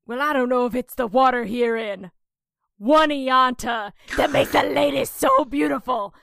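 a lightly voiced cheesy frontman crooning to the ladies; mock rock singer. Frontman flattering the Oneonta crowd, mock smarmy.

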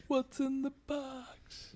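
very high, drawn-out wail; mock anguished cry, stretched slow; movie-line impression. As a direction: squeal the line slowly, high up. Quoting a famous film line.

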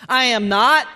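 loud, defensive retort; indignant denial. Acted. Peter answering his accuser by the fire, loudly indignant. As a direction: deny it firmly and loudly. Peter denying he follows Jesus.

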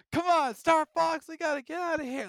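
heroic Chris Pratt voice. Retold or imitated. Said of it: "It's like".

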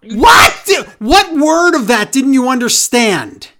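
loud, high disbelieving outburst; exasperated. Real, not acted. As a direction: cry out in disbelief. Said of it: "exasperated outburst".

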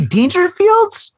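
surprised. Real, not acted. Hearing his favorite club closed, stunned disbelief. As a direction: stretch the name out in shock.

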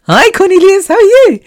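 giddy greeting. Retold or imitated. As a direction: squeal it out, starstruck. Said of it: "I'm like".

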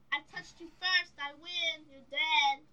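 loud playful duel taunt; triumphant kid declaring victory; excited. Pretend. Kid acts out a sword duel.

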